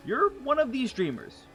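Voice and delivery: usual speaking voice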